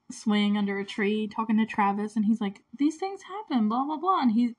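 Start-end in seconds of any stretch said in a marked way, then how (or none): 2.8-4.2 high, dismissive imitation; mock his excuse in a higher voice; mocking quote. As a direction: sing out the brush-off mockingly. Mocking retelling of the jerk.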